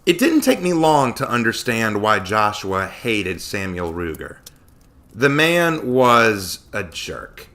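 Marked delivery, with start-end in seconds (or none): none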